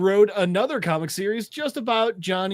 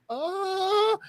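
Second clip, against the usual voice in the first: high, drawn uncertain "uh"; captive's uncertain noise; 13 semitones higher, 3 dB quieter than usual. Retold or imitated. Captive pelted with popsicles, high uncertain stall.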